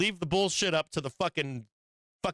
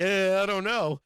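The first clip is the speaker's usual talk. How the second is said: drawn-out "Eh" of doubt, pitched up; mock hesitation; 4 semitones higher, 4 dB louder than usual. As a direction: play up a noncommittal shrug. Acts out his own doubt.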